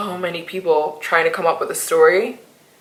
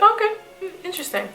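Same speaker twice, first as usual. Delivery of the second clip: amused mock-curious; 12 semitones higher, faster than usual. Retelling the boy's reply.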